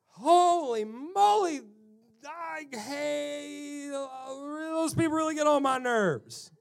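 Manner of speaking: loud, high, hammed-up exasperation; exasperated venting. Husband venting to wife about relatives, exasperated. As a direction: blow up with drawn-out mock frustration.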